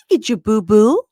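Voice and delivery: put-on high, loud cooing at a baby; hammed-up doting coo; mock baby talk. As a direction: gush it in a squeaky baby-talk voice. Stacy babying Florian, acted out.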